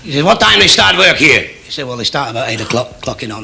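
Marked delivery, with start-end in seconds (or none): 0.2-1.4 higher-pitched, forceful questioning; demanding Soviet leader. Acted. Kosygin quizzes Heath in factory, demanding. 1.9-3.4 lower, softer, casual answer; mild reply. Acted. Heath answers visiting Kosygin, mild and quiet.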